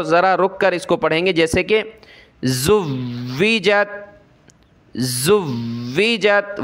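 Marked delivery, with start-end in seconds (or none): 2.4-6.6 زویجت with the واو pressed hard and held; stressed doubled waw. Shown as a demonstration. Teacher demonstrating doubled waw to students. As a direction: lean on the واو in زویجت and pause on it. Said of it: "واو پر میں سختی کر رہا ہوں، رک رہا ہوں".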